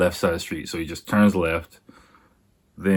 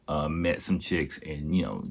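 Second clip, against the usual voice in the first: ordinary delivery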